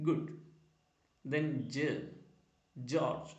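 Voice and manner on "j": "j" as "juh"; soft g sound. Shown as a demonstration. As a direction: say "j" as the first sound of George, not "jay". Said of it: "j എന്നും".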